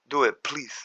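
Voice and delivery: usual speaking voice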